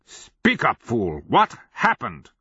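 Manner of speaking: high, loud interrogation; angry demand. A narrator's reading. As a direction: demand it with sharp impatience. Wizard demands answers from madman.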